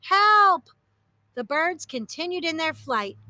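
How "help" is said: high, loud cry for help; shouting for help. A narrator's reading. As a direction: yell it across the water. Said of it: "he shouted through cupped hands".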